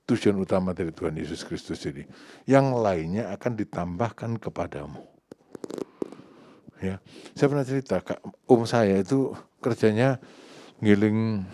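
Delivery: ordinary delivery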